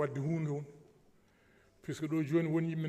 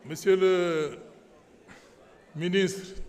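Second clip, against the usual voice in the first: much louder, calling the minister; loud address; 3 semitones higher, 10 dB louder, much slower than usual. Real, not acted. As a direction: call out to the minister. Addresses minister over the noise.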